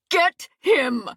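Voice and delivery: sharp high demand. A narrator's reading. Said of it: "she demanded".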